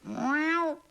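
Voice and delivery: very high, loud meow; cat meow. Acted. Cat waking for a nap, a high meow. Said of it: "thought the cat".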